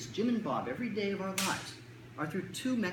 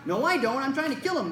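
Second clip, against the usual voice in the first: put-on defiant kid; 6 semitones higher, 7 dB louder than usual. Child fighting mom about brother.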